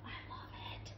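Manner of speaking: breathy hushed whisper; hushed whisper of delight over a gift. Real, not acted. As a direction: whisper it like a secret thrill. Admiring a gift item.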